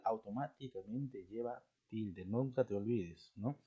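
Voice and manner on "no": ordinary talk, nothing marked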